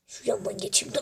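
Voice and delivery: hushed whisper while sneaking past. Real, not acted. Gamer whispering while sneaking past the balls. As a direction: breathe it out under your breath.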